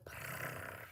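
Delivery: soft unvoiced purr; cat purr. Imitating the cat answering the lady, soft purr. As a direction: breathe out a little cat purr. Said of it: "And the cat goes".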